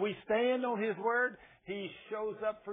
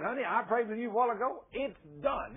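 quick, raised, no-doubt assurance; bold; 4 semitones higher, 3 dB louder than usual. Retold or imitated. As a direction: retell it boldly, brisk and higher, brooking no doubt. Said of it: "I was just about that bold with her".

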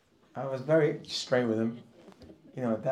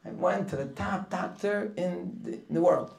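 mock boasting; 5 semitones higher, 4 dB louder than usual. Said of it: "I never heard someone say he went to a doctor and he was like the middle".

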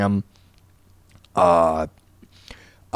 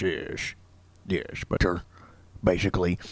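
rough, breathy near-whisper, much softer; Ned impression; 5 semitones lower, 12 dB quieter than usual. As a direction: rasp it out quietly the way Ned sounds now. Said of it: "now he kind of sounds a little bit like this".

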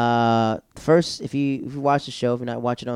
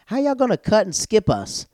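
high, louder indignant outburst; indignant protest; 11 semitones higher, 5 dB louder than usual. Retold or imitated. Friend objects to being skipped in line, indignant. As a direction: protest being cut, pitch way up.

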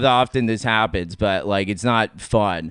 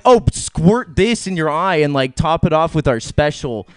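mock sweet offer; 5 semitones higher, 3 dB louder than usual. Mocking imagined cruel offer.

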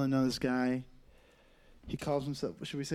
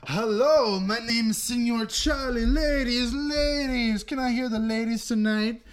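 high, loud, sing-song showman act; hammed-up Senor Charlie working the crowd; comedian's stage character; 6 semitones higher, 4 dB louder, slower than usual. Host mimicking open micer's joke to the ladies, hammed-up stage character. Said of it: "this fucking guy's jokes. He goes".

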